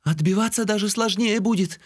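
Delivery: put-on high-pitched indignant girl's voice; indignant objection in a high female voice. A narrator's reading. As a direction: protest as an outraged girl, pitched well up. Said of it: "возмутилась девушка".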